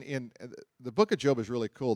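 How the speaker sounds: normal talking voice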